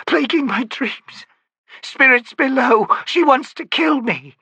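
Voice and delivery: high, frantic cries; a terrified old woman losing herself; raving terror. A narrator's reading. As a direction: howl it out in panic.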